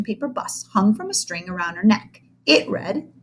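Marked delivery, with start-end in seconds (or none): none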